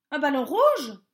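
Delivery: startled check; surprised. A narrator's reading.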